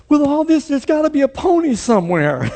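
put-on a child sure there's a treat hidden; eager kid. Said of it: "And the kid is going".

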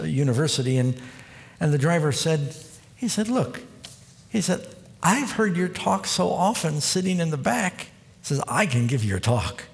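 normal talking voice

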